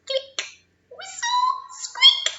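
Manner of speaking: high dolphin squeaks; dolphin sounds. Shown as a demonstration. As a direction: click then very high whistle and squeak. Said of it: "Can you do that?".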